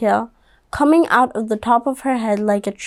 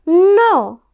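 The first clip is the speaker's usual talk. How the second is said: stubborn no; 7 semitones higher, 4 dB louder than usual. Acted. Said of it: "Stephanie said".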